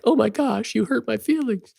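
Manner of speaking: high, whiny mock voice of someone offended; mock hurt whine. To the co-host, mimicking a friend who got blasted, in a high mock whine.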